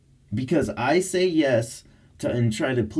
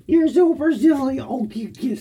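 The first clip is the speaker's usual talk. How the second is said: high, louder teasing coo; 12 semitones higher, 5 dB louder than usual. Real, not acted. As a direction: squeal it at the dog. Repeat to dog.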